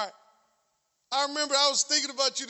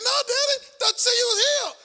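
put-on higher, louder kid's teasing voice; son teasing dad; 10 semitones higher, 3 dB louder than usual. Son calling out his father on the basketball court, teasing. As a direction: tease your dad like a grinning teenage son. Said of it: "He said".

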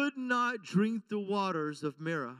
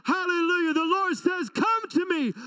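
higher, louder, crying out; preaching at full force; 7 semitones higher, 5 dB louder than usual. Acted. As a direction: build to a shouting climax. Climactic preaching.

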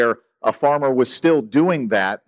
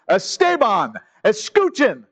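a lightly voiced scolding teacher, raised pitch; imitating Spanish teacher; 6 semitones higher than usual. Spanish teacher scolding a chatty pupil, imitated raised command. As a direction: call the talkative kid to order. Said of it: "my Spanish teacher said this to me".

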